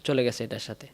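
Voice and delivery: ordinary delivery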